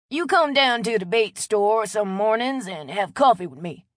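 put-on friendly old local, loud and chatty; a neighborly Louisiana old-timer talking up a stranger; old Cajun man Chet. Chet invites her for coffee.

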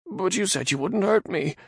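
high nervous man voice; frightened Radagon. Acted. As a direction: ask in timid fear. Radagon timid.

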